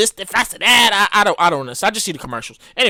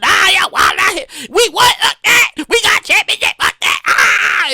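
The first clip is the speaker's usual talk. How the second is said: mock rioting fan; 8 semitones higher than usual. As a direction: whoop it up like a drunk fan celebrating.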